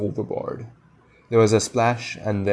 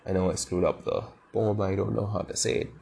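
usual speaking voice

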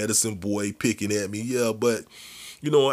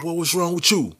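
uncle voice; 9 semitones higher than usual. Retold or imitated. Uncle voiced in retold story.

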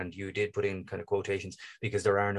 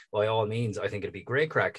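normal talking voice

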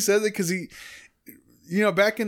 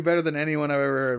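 ordinary talk

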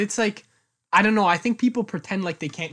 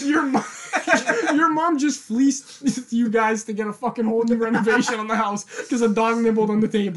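higher, laughing mockery; laughing disbelief; 4 semitones higher, 3 dB louder than usual. Student mocks the girl about the dog story, laughing. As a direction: laugh at how absurd it is. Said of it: "I'm like".

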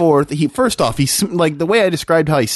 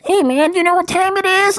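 imitating a stranger's high-pitched voice; high mock voice, far above natural pitch; odd high voice; 13 semitones higher than usual. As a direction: put on a squeaky-high panhandler voice. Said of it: "he says it in a voice like that".

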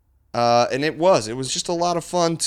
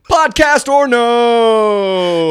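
slow drawn out; 7 semitones higher, 7 dB louder, much slower than usual. Acted. Drawn out correction.

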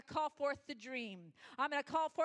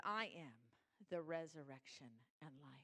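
hushed; 8 semitones lower, 9 dB quieter than usual. Acted. Soft, intimate repetition of scripture.

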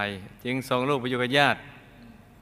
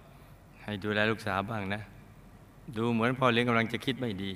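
hushed warning; 3 semitones lower, 7 dB quieter than usual. Retold or imitated. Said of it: "สะกิดบอกว่า".

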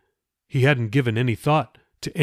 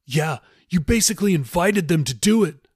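higher, quick comeback; retorting; 6 semitones higher than usual. A narrator's reading. Jeffy retorts to whipped Raoul, higher and argumentative. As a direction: throw it back at him. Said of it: "Jeffy retorted".

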